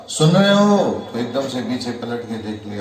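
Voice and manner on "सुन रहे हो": a sharp, loud, higher call; calling loudly. Retold or imitated. Wife finally calls her husband loudly, shouting. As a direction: raise the voice to make him hear. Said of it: "अब जोर से उसने बोला".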